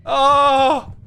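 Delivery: high, loud panicking cries; panicked. Real, not acted. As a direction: panic at a dead end. Dead end, enemy near.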